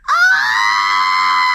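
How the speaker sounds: a huge, low, loud troll scream. A narrator's reading. Trolls terrified by the smiling bear, screaming. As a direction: trolls scream in terror at once. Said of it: "screamed the trolls".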